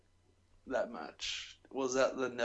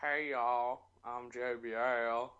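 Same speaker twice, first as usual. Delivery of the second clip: slow Southern drawl at normal pitch; JBL impression; much slower than usual. Shown as a demonstration. Redoes the impression at lower pitch.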